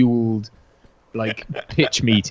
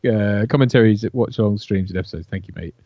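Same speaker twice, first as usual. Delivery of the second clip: normal talking voice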